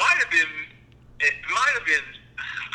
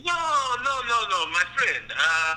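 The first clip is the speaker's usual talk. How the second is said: higher, halting French-ish protest; French-like accent; 6 semitones higher than usual. Retold or imitated. Customer correcting the video clerk, halting French-like accent. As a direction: imitate a foreign-sounding customer objecting. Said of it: "do the accent as I heard it".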